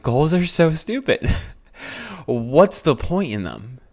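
giggling through a mock rant; laughing at herself. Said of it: "I just started laughing".

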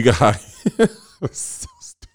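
breaking up mid-sentence, high giggle; real laughing. Real, not acted. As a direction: try to talk and collapse into laughter. Cracks up at own joke.

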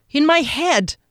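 high, frightened reply; nervous, pitched well up; anxious answer. A narrator's reading. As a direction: insist as a cornered captive. Captive answers under interrogation.

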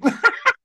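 loud high laugh; high burst of laughter. Real, not acted. Big laugh at joke.

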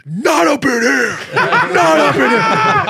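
high, slow, laughing shout; shouted catchphrase. Retold or imitated. Linebacker points at the crowd and shouts the line. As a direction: bellow it out, drawn out and high. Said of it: "he points right at me and he goes".

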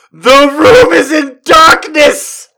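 slow, loud, very high shriek; hysterical squeal. Real, not acted. Reader losing it as the door opens, high slow squeal. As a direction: shriek the line while breaking into laughter. Reader cracking up mid-story.

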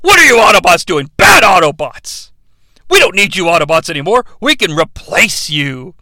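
loud scolding of bad robots; angry scolding. Stanley Tucci yelling at the Autobots, mock angry scolding. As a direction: scold them like naughty dogs. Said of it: "Stanley Tucci figures this out".

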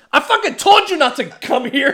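high, loud yell of get out; shouted get out. Retold or imitated. Retelling the movie scene, a high loud shout. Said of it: "and he's like".

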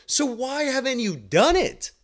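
high, pushy challenge to the patient; blunt therapist voice. Shown as a demonstration. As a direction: press her, high and insistent. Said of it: "And I talk just like this".

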